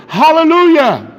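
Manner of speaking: loud, high hallelujah; shouted hallelujah. A narrator's reading. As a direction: shout it to heaven. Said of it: "they shouted".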